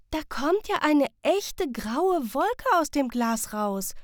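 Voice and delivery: put-on high, slow, astonished child's voice; amazed child. A narrator's reading. Sam seeing a grey cloud rise from the watch, amazed. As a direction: marvel at the cloud like a wide-eyed kid. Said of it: "stellt Sam staunend fest".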